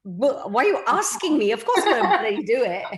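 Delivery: laughing, emphatic mock incredulity; retold eager disbelief. Actress retelling her answer to a job offer, eager disbelief. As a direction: blurt it out as an obvious yes.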